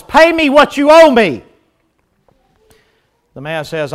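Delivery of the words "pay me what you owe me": demanding creditor. Acted. Creditor demanding payment in parable.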